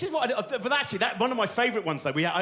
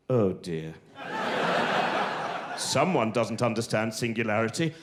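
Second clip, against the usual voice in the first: lightly voiced disapproving professor; 8 semitones lower than usual. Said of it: "two Nobel Prize winners going".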